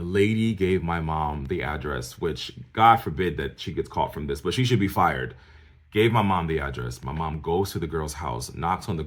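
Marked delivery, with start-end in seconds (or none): none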